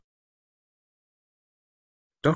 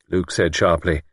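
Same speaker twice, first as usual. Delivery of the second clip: usual speaking voice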